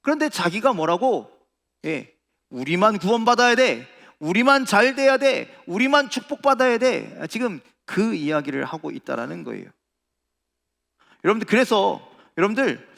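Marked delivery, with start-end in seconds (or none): 2.5-7.3 loud, high insistent demand; selfish insistence. Acted. Preacher voicing Jonah's refusal to share salvation, insistent. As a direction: demand it only for your own side, raised voice.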